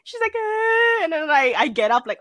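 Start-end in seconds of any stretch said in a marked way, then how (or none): 0.3-1.0 high mock sob; imitated crying whine. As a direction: whimper like a crying little kid. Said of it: "Is she crying?".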